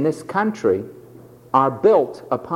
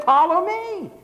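high commanding call; 11 semitones higher than usual. Retold or imitated. Jesus' command, quoted.

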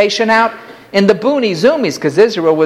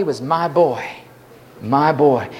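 tender; 6 semitones lower, 5 dB quieter than usual. Acted. Centurion's tender love voiced.

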